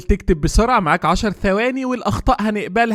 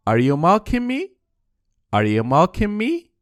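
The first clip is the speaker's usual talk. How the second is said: slow, clear, rising at me; slow model question; much slower than usual. Shown as a demonstration. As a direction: ask it slowly for learners. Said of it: "يا ترى إزاي نقول". (سؤال بطيء وواضح)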